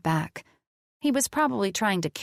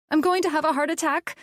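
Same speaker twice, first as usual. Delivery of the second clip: high, fast panicked cry; 10 semitones higher, faster than usual. A narrator's reading. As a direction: blurt it out in terror. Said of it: "I cried".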